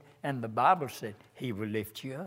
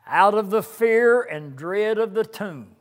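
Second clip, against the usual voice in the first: hymn lines intoned, swelling on each opening line; preacher reciting a hymn, rising then hushing; hymn recited; 6 semitones higher, 11 dB louder than usual. Acted. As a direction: chant the hymn words, falling to a hush on the refrain. Preacher resumes hymn verse.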